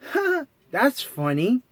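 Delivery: puzzled little cartoon mouse; Mickey voice.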